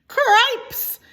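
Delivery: high, loud startled squeak; a frightened little mouse yelps; startled cry. Acted. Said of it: "said Furlow".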